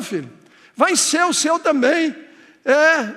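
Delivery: ordinary talk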